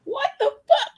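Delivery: high laughing what the fuck; squeaky stunned outburst; shocked. Real, not acted. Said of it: "I was tight".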